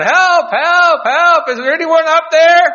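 high, very loud desperate call; full-throated cry for help; screaming for help. Acted. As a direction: yell up a cliff for rescue. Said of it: "he began screaming for help".

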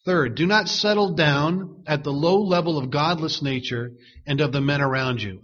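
ordinary talk

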